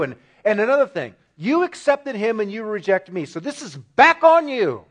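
loud, heated complaint; blaming God. Retold or imitated. Cain hurling blame back at God, heated and loud. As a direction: throw it all back on God, loudly.

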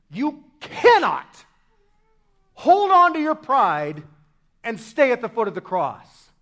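loud, emphatic pulpit delivery; forceful preaching. Real, not acted. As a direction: drive each phrase home, punching key words hard.

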